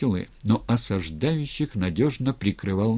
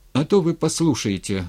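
bitter, higher retort; bitter exclamation; 6 semitones higher, 4 dB louder than usual. A narrator's reading. Matvey reproaching the ataman who won't listen, bitter exclamation. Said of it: "горько воскликнул Матвей".

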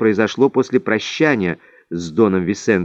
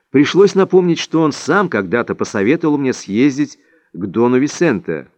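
ordinary delivery, nothing marked

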